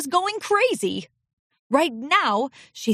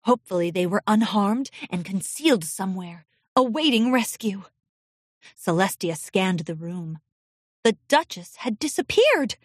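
ordinary delivery

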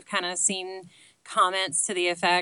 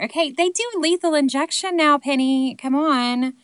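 higher, louder mock-indignant correction; exasperated protest; 9 semitones higher, 7 dB louder than usual. Real, not acted. Correcting Penny about lethal injection, exasperated and higher. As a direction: protest it like she's being ridiculous. Scolding question writer's outdated premise.

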